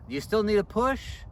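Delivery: loud, high call across the yard. Retold or imitated. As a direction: shout it to someone far off. Husband calling into dark yard.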